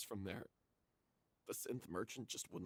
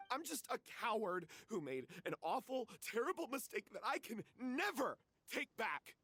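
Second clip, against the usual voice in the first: high, strained self-reproach; guilt-stricken lament; despairing self-blame; 8 semitones higher than usual. Acted. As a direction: tear yourself down in despair. Self-loathing confession.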